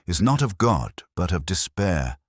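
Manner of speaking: ordinary delivery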